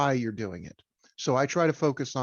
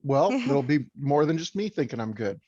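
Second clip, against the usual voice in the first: usual speaking voice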